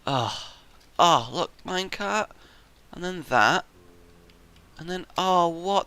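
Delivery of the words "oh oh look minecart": ordinary delivery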